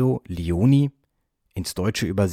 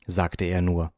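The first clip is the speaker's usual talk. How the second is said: ordinary delivery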